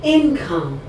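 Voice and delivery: ordinary talk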